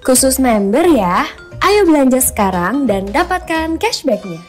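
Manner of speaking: loud, punchy promo read; hard sell ad. Acted. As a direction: push the deal hard and loud. (iklan promosi yang keras dan bersemangat)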